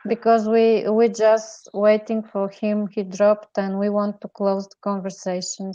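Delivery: usual speaking voice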